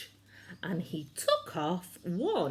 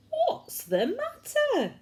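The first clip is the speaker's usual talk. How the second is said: kind giant asking; 5 dB louder than usual. Acted. George asks kindly, high voice.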